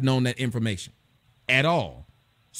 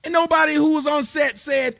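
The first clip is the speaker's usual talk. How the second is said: louder and much higher, fired up; indignant outburst; 12 semitones higher, 3 dB louder than usual. Real, not acted. Complaining to his co-host about the accent, an indignant loud outburst. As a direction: let the outrage burst out.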